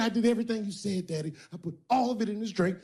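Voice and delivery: high, fast pleading voice; scared woman. Retold or imitated. The bottom bitch pleading with her pimp, high and fast. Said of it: "She said".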